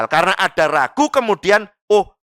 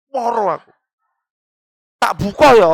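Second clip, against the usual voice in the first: plaintive put-on moan, pitched up; whining sick complainer; 5 semitones higher, 4 dB louder than usual. Asking to break the fast while feigning pain, whiny. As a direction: whimper it, angling for permission.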